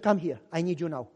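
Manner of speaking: low, quiet and urgent; wife pulling her husband away, low and urgent; wife calling him. Retold or imitated. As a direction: call him aside quietly.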